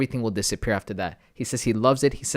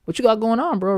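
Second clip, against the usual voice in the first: high, loud mock taunt; mocking passers-by; 8 semitones higher, 7 dB louder than usual. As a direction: lightly imitate people mocking his pink pants. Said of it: "People were like".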